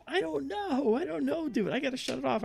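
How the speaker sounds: very high mock-panicked voice; retold panic, high and urgent; imitating panicky woman. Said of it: "she's getting panicky now".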